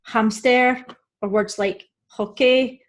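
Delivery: hamster and hockey with the H heard; imported words hamster, hockey keeping their H; sounded H. Shown as a demonstration. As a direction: breathe out a clear H in hamster and hockey. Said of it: "You may hear the H".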